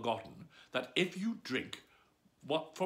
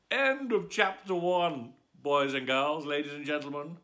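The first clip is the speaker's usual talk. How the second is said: announcer sign-off; 2 semitones higher, 8 dB louder than usual. Acted. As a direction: address the audience grandly.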